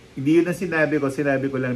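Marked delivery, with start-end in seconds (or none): none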